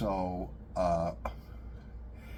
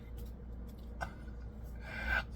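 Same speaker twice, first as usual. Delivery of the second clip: silent wheezing laugh; 11 dB quieter than usual. Real, not acted. Laughing after telling the story.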